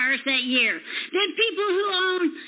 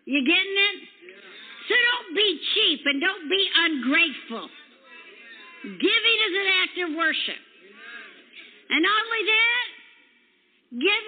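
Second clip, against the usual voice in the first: raised, ringing preacher's voice; fiery preaching; 4 semitones higher than usual. Acted. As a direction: drive it home to the congregation. Preacher exhorting the congregation.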